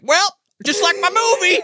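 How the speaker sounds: high, loud mock-villain squeal; gleeful villain cry. Cousin bursting round the corner, gleeful villain cry. Acting the villain revealing himself.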